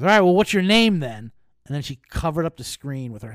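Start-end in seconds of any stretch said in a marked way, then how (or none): 0.0-1.3 higher, louder mock quiz of a suspicious clerk; mock stern gate agent. Gate agent quizzing him on his name, louder mock sternness. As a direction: lightly play the gate agent testing him. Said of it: "She goes".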